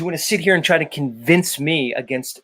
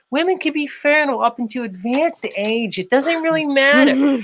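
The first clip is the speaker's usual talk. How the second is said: much higher put-on woman's voice; role-play her, pitched way up; playing Special K; 9 semitones higher than usual. Host plays Special K in role swap. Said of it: "I'm gonna be Special K".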